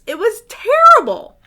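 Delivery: high, loud, overdone dismay; mock horror. A narrator's reading. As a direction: ham up the despair.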